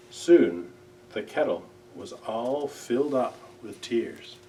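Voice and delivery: plain reading voice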